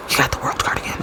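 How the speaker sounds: hushed whisper of the same line; secretive whispered repeat. Real, not acted. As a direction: lean in and whisper it. Whispered aside to viewers.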